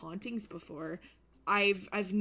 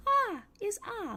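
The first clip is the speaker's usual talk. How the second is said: very high, slow squeak; drawn-out high baby-talk call; high squeaky wake-up call; boyfriend's silly voice; 5 dB louder than usual. Retold or imitated. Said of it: "I heard my boyfriend go".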